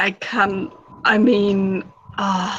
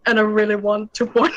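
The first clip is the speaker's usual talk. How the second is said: fast, giggling through the words; laughing; faster than usual. Real, not acted. Player pitching arrows to the guard, laughing. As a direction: push through her own giggles.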